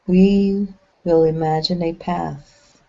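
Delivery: slow-paced meditation voice with long pauses between short phrases; even, measured channeled delivery, leaving lots of silence; guided meditation. Acted. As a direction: guide them gently inward, phrase by phrase.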